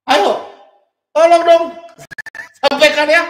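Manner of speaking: high, loud urging with a chuckle; loud challenge. Real, not acted. Host daring opponents to show the verse, loud urging. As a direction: egg them on at the top of the voice.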